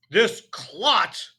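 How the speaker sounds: high, very loud contempt; roaring headmistress; booming insult. A narrator's reading. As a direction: boom the insult at the boy. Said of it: "boomed the headmistress".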